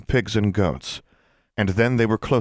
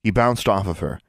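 ordinary delivery, nothing marked

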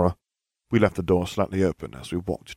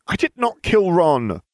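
higher, louder, slow indignant snap; snapping; 11 semitones higher, 7 dB louder than usual. Acted. Hermione snaps at Harry after smacking his arm, indignant and louder. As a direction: bite each word back at him.

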